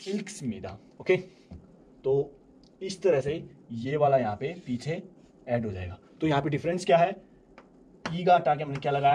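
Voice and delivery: ordinary talk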